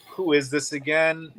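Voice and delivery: normal talking voice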